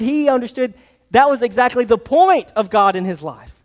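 ordinary delivery

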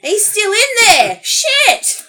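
high, loud, laughing fake panic; mock alarm. Friend playing along with the co-host's gag, mock alarm. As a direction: squeal it slowly as a joke. Joins the joke with pretend alarm.

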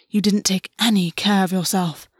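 mildly hissed accusation; hissed reproach. Acted. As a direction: hiss it with contained anger. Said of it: "August hissed".

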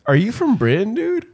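put-on high rising kid's question; puzzled kid. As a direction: ask naively, pitch climbing sharply at the end. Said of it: "I was just like".

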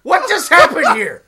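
a loud retold what-the-hell moment; mock baffled shout. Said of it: "And I'm like".

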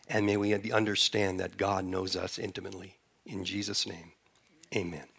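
soft, hushed prayer voice fading away. Real, not acted. Pastor praying over his congregation, hushed and fading.

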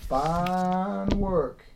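folksy drawling praise; slow drawl. Acted. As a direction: stretch the vowels right out in a drawl. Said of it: "I'm stuck on slow drawl".

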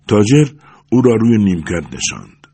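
ordinary delivery, nothing marked

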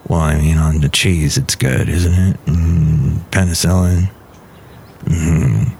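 very low, slow, dopey voice; deep goofy engineer; deep character voice. Acted. John Deere character answers.

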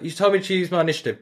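mock whiny excuse. Mimicking crewmate's excuse.

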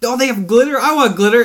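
put-on high, eager kid-like plea; mock childlike excitement. Kid who wants glitter too, high mock excitement. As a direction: pipe up high, wanting it badly.

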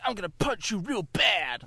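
high imitation of a boy's American bragging; son's American accent. Dad imitates his three-year-old threatening, high American accent. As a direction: put on a high American kid voice. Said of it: "He's like".

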